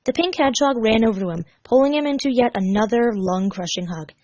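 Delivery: plain reading voice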